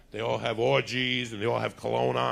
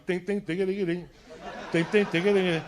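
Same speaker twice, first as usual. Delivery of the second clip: imitated radio chimes; 6 semitones higher, 3 dB louder than usual. Imitating Japanese radio music.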